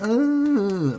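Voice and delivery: long, loud, high groan; drawn-out groan of exasperation; exasperated groan. Acted. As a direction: drag out a fed-up "uh". Fed-up groan at the tweets.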